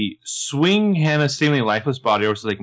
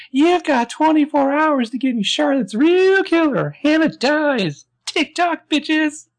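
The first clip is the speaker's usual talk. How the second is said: very high, slow, loud talking-doll voice; creepy doll voice; 5 dB louder, slower than usual. Retold or imitated. Recapper playing the doll's automated message, creepy doll voice. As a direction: mimic a pull-string doll delivering a threat, stretched out. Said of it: "I love the voice".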